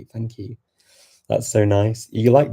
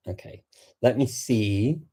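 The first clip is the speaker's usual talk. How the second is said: normal talking voice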